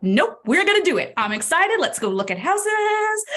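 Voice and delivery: eager agent, high and loud, slowing on the last line; upbeat excitement. Pretend. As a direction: pump it up like you can't wait. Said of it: "be like".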